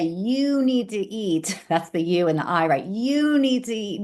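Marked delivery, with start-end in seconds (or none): 0.0-1.6 slow, drawn-out lecturing "You need to eat"; preachy quote. Shown as a demonstration. Telling a friend what to eat, in a slow preachy drone. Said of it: "'cause if I say". 2.8-4.0 higher, insistent "You need to eat"; pushy lecture. Shown as a demonstration. Nagging a meat-eating friend, in a higher insistent voice. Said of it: "'cause if I say".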